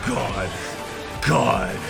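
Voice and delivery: ordinary talk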